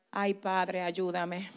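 whispered-down prayer; hushed prayer. Real, not acted. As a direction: pray it under your breath. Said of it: "personal prayer".

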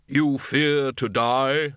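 slow, solemn Death voice; measured, unhurried, otherworldly Death; grim reaper voice. A narrator's reading. Death asks slowly.

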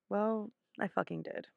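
normal talking voice

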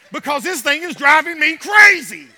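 loud laugh while complaining; laughing exasperation. Real, not acted. Frustrated with the microphone, laughing.

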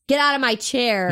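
mock dad's order. Dad comes over to claim his chair, mock-annoyed.

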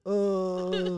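choked-up ugly crying sob; a wet, blubbering cry; snotty crying. Real, not acted. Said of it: "Why you ugly crying?".

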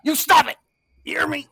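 shouted high scolding; mock angry yell. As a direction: yell it like an angry New Yorker. New Yorkers scolding, retold.

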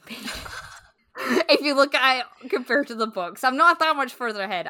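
loud, high, laughing through the words. Real, not acted. To her co-host, laughing about barely progressing in the book. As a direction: laugh it out, a bit embarrassed. Laughing at how little she's read.

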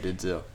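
"Denzel" as den-ZELL, said softly; softly mispronounced "Denzel", second vowel clear; wrong Denzel pronunciation. Shown as a demonstration. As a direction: give "Denzel" its full "zell" ending. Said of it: "you call him that".

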